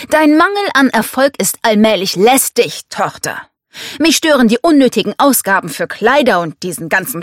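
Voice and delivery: stern patriarch airing his grievances; domineering father, displeased. Acted. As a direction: lay down the law to a disappointing daughter.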